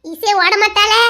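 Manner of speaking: normal talking voice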